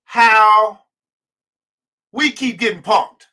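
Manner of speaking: each word dragged out slowly; measured, simmering frustration; slow, deliberate anger. Real, not acted. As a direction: spell it out, pausing hard between words. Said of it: "I woke up mad this morning".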